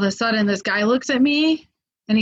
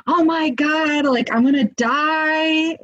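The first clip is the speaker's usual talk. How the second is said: mock panic; 7 semitones higher than usual. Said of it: "instead of being like".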